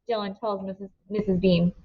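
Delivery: ordinary delivery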